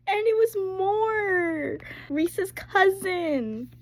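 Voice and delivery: high, loud, slow thrilled squeal; excited squeal. Real, not acted. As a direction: squeal it out with delight. Thrilled at rescue.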